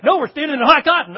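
loud, high, quick exclamation; emphatic correction. Acted. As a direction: burst in to set the newcomer straight. Acted insistent reply to confused newcomer.

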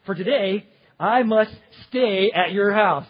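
loud, emphatic announcement, slowing at the end; calling out. Acted. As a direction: proclaim it to someone up a tree. Jesus announcing to Zacchaeus in tree.

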